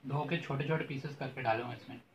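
chewing while talking; muffled food-filled speech; mouth full of food. Real, not acted. Seen 1: Bite taken at -2; lips pursed, chewing motion while speaking.

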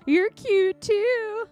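high, drawn-out cutesy gushing; cutesy flirting. Acted. Flirty game character returning a compliment, cutesy. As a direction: gush it slowly and sweetly, up high.

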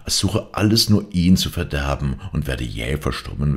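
ordinary delivery, nothing marked

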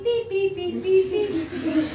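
beeping like a dialing phone; short sharp imitated beeps. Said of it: "all they can hear is beep".

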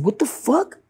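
high, shocked squawk of disbelief. Retold or imitated. Speaker reacting to friend's rotting leg, shocked and high. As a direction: react like you can't believe it. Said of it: "I said".

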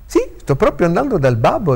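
put-on high, loud, jovial old lawyer; hearty and loud, pitched high. A narrator's reading. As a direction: answer with unfailing good cheer. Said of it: "sempiterna giovialità di accento".

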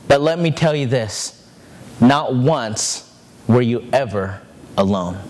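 low-pitched, measured fatherly comfort; solemn reassurance. Acted. Father tells his son he was never alone, solemn reassurance.

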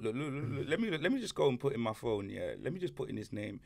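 ordinary delivery

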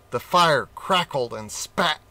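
ordinary delivery

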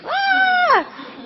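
sudden high shriek of fright; retold scream. Startled by a tall man in the dark hallway, shrieking. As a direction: shriek like you just bumped into a stranger in the dark. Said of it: "I scream bloody murder".

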